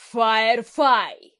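loud shout. A narrator's reading. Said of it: "этим громким криком".

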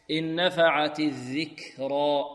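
slow recitation letting breath flow after the k in "الذكرى"; breathed kaf. Shown as a demonstration. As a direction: chant slowly, airy release on the kaf of "الذكرى". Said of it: "جريان نفس". (كاف «الذكرى» مهموسة بترتيل بطيء)

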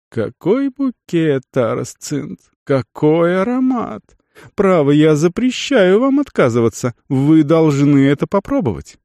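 high, rapturous merchant praising his wine; gushing delight. A narrator's reading. Merchant urging guest to drink, rapturously insisting. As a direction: savour every word, then insist.

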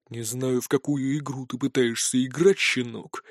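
low, quiet menacing threat; darkly menacing. A narrator's reading. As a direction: turn cold and threatening. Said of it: "сразу же помрачнел заискивающий толстячок".

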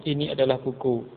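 "Ini adalah buku" softly on one level tone; flat statement intonation. Shown as a demonstration. Teacher shows Indonesian statement tune, flat intonation. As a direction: keep "buku" level, a plain piece of news. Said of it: "Datar gitu, ya, intonasinya".

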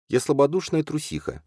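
high, lightly voiced heroine; self-reproaching confession. A narrator's reading. Alzhana admitting cowardice to Lali, self-reproaching.

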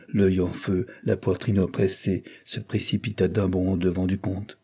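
ordinary delivery, nothing marked

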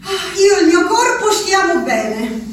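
ordinary delivery

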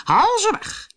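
very high, pleading command; hammed-up high royal cry; distressed king. A narrator's reading. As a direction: wail the order out in panic. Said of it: "zei de koning".

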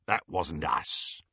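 low, slow, soft menace; surly henchman. A narrator's reading. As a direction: sullen low reply, drawn out. Greyback answers Lucius in character voice.

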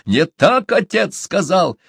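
high, slow, emphatic objection; heated protest. A narrator's reading. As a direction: protest hotly, drawing it out. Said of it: "с горячностью воскликнул Чумбока".

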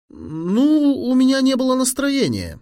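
put-on high, squeaky-boy excuse; boy making excuses. A narrator's reading. Sherman finds an excuse for his friend, high-pitched. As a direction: dodge it in a high voice.

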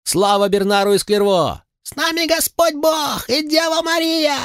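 very high chanted cheer; shouted cheer. A narrator's reading. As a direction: hail them at the top of the voice. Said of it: "дружно выкрикнули".

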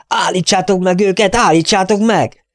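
high, urgent squeal; frantic shriek. A narrator's reading. Little lord to his men as cattle escape, shrieking. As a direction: shriek the order in alarm. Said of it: "visította a kis főrend".

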